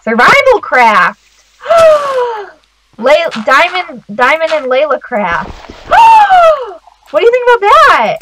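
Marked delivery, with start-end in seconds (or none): 1.6-2.5 high-pitched, drawn-out gasp; excited gasp. Real, not acted. Player hits on a name for the series, excited gasp. 5.6-6.8 long, high gasp; excited gasp. Real, not acted. Player loves the name just thought up, excited gasp.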